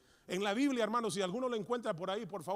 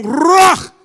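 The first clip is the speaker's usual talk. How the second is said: Ruach roared like a lion, high and loud; 9 semitones higher, 29 dB louder than usual. Shown as a demonstration. Preacher roaring the word like a lion. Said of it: "Así como león".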